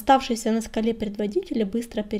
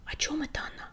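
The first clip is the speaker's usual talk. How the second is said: hushed, higher-pitched puzzled whisper; surprised whisper; 6 semitones higher, 8 dB quieter, faster than usual. A narrator's reading. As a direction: whisper it in surprise into a friend's ear. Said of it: "удивлённо шепнул он на ухо другу". (удивлённый шёпот)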